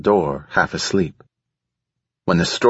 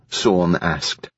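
ordinary delivery, nothing marked

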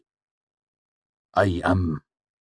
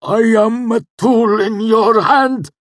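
very high, loud cry like a wounded animal; agonized cry; 11 dB louder than usual. A narrator's reading. Cinder submitting under torture, crying out. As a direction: scream it through convulsing pain. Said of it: "convulsed and cried out".

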